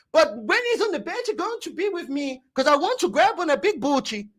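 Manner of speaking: mocking the director at Sky Sports, Brazilian-accented; imitating Edu. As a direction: ham up Edu's Brazilian accent to the press. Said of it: "Edu comes in front of Sky Sports".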